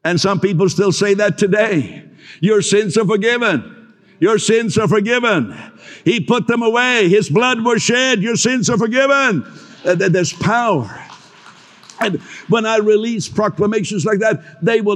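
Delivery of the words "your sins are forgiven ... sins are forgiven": rising, fervent declaration, a little higher each line; proclamation. Acted. Preacher declaring forgiveness over the congregation, fervent. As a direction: proclaim it to them with growing conviction. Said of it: "This is a proclamation service".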